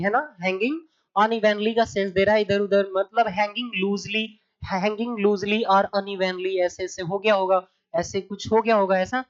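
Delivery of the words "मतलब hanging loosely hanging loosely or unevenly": slow explanation. Shown as a demonstration. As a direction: draw out the definition gently. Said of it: "teacher explains meaning".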